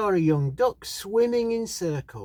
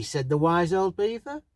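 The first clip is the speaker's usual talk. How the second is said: plain reading voice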